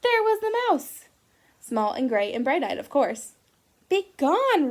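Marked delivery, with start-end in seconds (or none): 0.0-1.1 high, loud gasp of discovery; spring the surprise on the kids; surprised reveal. A narrator's reading. Surprise reveal in read-aloud. 3.9-4.7 high, loud bear roar. Acted. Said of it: "roared Bear".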